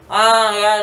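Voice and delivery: usual speaking voice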